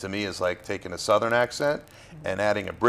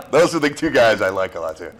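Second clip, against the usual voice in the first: laughing; 7 dB louder than usual. Real, not acted. Still laughing after laugh.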